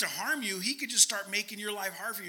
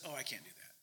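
much lower, very soft murmur; quiet refusal; 8 semitones lower, 14 dB quieter, faster than usual. Acted. Rich young ruler turning down the answer, quiet low refusal. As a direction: back away under your breath, low and small.

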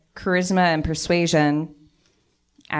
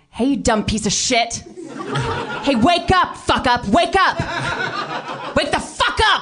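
intimidating yell; 7 semitones higher, slower than usual. Acted. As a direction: yell him awake, half laughing. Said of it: "that's intimidation".

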